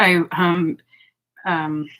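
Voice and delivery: usual speaking voice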